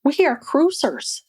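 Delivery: playfully rallying herself as a proud cruiser; light self-mocking cheer; mock cruise enthusiasm. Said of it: "And then I was like".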